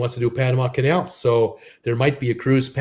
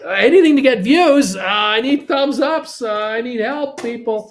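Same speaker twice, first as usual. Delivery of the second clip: mock pleading; 9 semitones higher, 5 dB louder than usual. Begging viewers for thumbs up.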